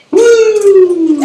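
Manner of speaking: high loud wrestler woo; Ric Flair woo. Retold or imitated. [name] mimicking a local whooping like a wrestler. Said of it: "Like Ric Flair".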